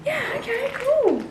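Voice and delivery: very high, breezy neighbour. Retold or imitated. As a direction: toss it off high and airy. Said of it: "He was like".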